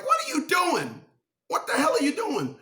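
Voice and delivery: high shocked yelling at the dog. Retold or imitated. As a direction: yell in disbelief at the dog.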